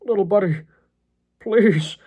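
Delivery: high, strained, halting plea; pained begging with a gap between phrases; struggling to speak. A narrator's reading. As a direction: force out each word through pain. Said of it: "John struggled to speak".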